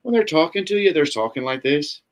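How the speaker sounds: high-starting Dublin lilt; Dublin accent. Shown as a demonstration. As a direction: talk in a questioning Dublin accent. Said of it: "like they're asking everybody a question".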